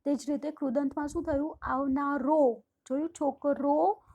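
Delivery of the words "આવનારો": આવનારો said very slowly with a louder final રો; drawn-out ending. Shown as a demonstration. Teacher pointing out the masculine ો ending, said very slowly. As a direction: stretch આવનારો and lean on its last syllable. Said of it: "પાછળ ઓ".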